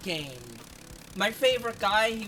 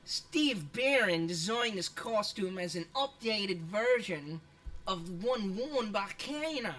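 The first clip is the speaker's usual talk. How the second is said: slow put-on Australian read; Aussie accent. Streamer reading Kano's costume description, mock Australian accent. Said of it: "I'm done with the accent".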